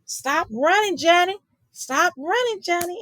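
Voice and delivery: high, strained yell of a frazzled teacher; demonstrating a yelled command; mock screaming at a child. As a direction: shout it across the classroom, half-acted. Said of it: "you're screaming".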